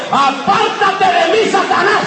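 high, slow, thundering rebuke; commanding shout. Retold or imitated. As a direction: drive Satan away with a roar. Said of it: "De una vez le dijo".

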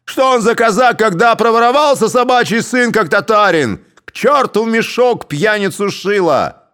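loud, high, cursing yell; abusive shout. A narrator's reading. Crowd cursing Shilo, angry shouting. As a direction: curse him out to the crowd. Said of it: "кричала с бранью толпа".

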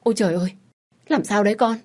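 startled mother yelling at a cut hand; alarmed cry. A narrator's reading. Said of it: "Mẹ tôi hốt hoảng la lên".

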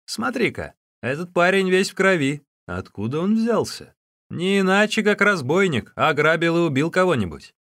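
high, loud exclaiming at the prisoner; loud, high-pitched speculation among captors; captors' rough remarks. A narrator's reading. Captors exchange remarks about prisoner.

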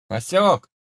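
loud call from the next room; from another room. A narrator's reading. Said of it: "Громкий голос Андрея раздался из соседней комнаты".

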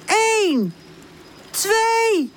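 high, spaced countdown call; high-pitched drawn-out starting shout. A narrator's reading. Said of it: "roept Pip".

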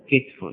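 كتف as kit-fun; two-syllable كتف: KIT-fun; kasra, silent middle. Shown as a demonstration. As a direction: say كتف with i after kaf, no vowel on ta. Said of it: "هذه اللغات الثلاث".

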